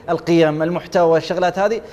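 normal talking voice

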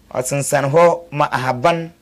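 ordinary delivery

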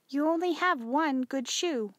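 lightly voiced mother.

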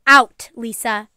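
loud, slow command; firm. A narrator's reading. Mother sends Lisa to the garage, firmly. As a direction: order them out, no argument. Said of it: "said firmly".

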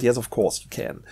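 usual speaking voice

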